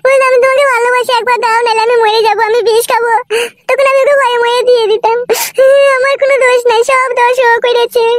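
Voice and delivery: tearful confession. Acted. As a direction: whimper through the accusation, pleading innocence.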